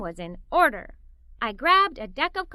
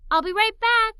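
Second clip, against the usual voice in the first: bright, louder, higher call; exclaimed; 7 semitones higher, 6 dB louder than usual. A narrator's reading. Child calling to parents, excitedly exclaimed. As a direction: call it out eagerly.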